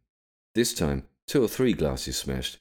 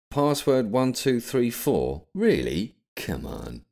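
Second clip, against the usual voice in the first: incredulous; 7 semitones higher, slower than usual. A narrator's reading. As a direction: read it out in disbelief, then sink to weary exasperation. William scorns the silly password.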